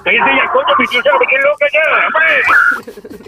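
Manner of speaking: shouting at someone off-phone; sudden high scolding yell. Acted. Caller scolds her aunt.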